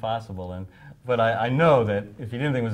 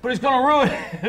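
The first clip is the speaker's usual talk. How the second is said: urgent laughing plea; 9 dB louder than usual. Retold or imitated. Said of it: "He said".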